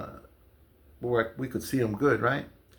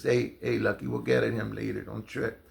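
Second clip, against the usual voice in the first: softer, hushed word to a friend; quiet aside; 5 semitones lower, 6 dB quieter than usual. Retold or imitated. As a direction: lean in and keep it low so nobody hears. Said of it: "leans over real quiet and he says".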